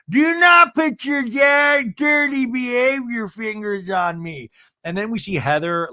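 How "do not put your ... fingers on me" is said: high, slow, tipsy mock shout; Meredith. Tipsy housewife at dinner, high slurry mock yell. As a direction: imitate a loose, drawn-out outraged wail. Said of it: "I like when Meredith".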